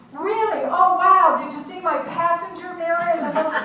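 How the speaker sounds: high, gushing mock amazement; over-eager surprise. Driver asking the state trooper about her mirror, over-eager. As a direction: pitch it way up, eager and quick.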